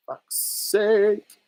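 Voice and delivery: high-pitched exasperated swearing. Real, not acted. Streamer losing his place in fast-moving comments, high-pitched exasperation. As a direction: let out a high, exasperated curse.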